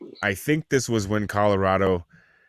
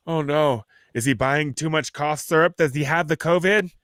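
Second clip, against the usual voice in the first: raised, fretful busybody voice; mock alarm; 6 semitones higher than usual. Mimicking shoppers eyeing a neighbor, mock alarmed suspicion. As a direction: play up the suspicion, pitch climbing.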